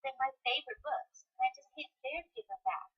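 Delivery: put-on high, soft girl character voice; Susie. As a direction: voice the girl's line softly, pitched well up. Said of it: "We'll record her voice".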